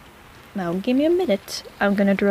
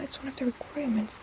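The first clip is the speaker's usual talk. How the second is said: whispered aside to herself; 3 semitones higher, 12 dB quieter than usual. Acted. Healer whispering an aside to herself. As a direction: whisper it under your breath.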